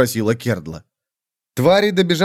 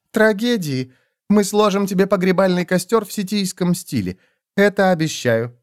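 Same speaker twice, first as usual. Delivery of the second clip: lightly voiced, higher sly threat; sly mocking woman; 7 semitones higher than usual. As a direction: tease him with a sweet threat. Smiles threatens Corik. (язвительная угроза с улыбкой)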